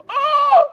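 sharp high ah of emotion; high cry. Retold or imitated. Preacher cries out at his son's words, sharp and high.

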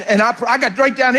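ordinary talk, nothing marked

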